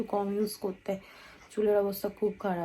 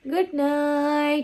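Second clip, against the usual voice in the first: high, long-stretched good night; drawn-out sign-off; 6 semitones higher, 5 dB louder, much slower than usual. Real, not acted. Vlogger ending the day's vlog for viewers, drawn-out high good night. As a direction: stretch out the last word, pitched up.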